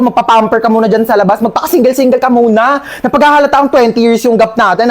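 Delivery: normal talking voice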